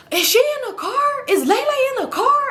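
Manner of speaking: re-enacted high-pitched yell; hammed-up yell toward the car. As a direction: call out a very high, accusing question. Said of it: "And I'm yelling".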